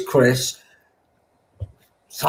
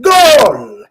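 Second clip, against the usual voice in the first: high, loud mock goal cry; shouted goal; 12 semitones higher, 7 dB louder than usual. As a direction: yell it like a celebrating fan. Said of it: "and they went".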